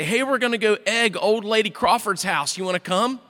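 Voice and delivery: put-on teen buddies hyping a prank; eager kid friends.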